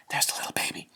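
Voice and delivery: put-on soft whisper about the baby; softly whispered aside. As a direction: hush as if the baby sleeps.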